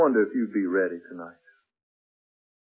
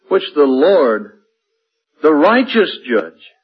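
louder, slowed, weighty reading that builds; emphatic scripture reading; 4 semitones higher, 7 dB louder than usual. Acted. Preacher reads the crown of righteousness verse, building and loud. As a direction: hammer each phrase of the verse.

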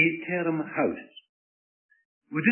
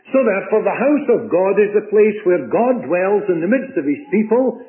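higher and louder, driving the point home; preaching at full force; 5 semitones higher, 4 dB louder than usual. Acted. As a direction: build up like a preacher at the climax. Sermon climax.

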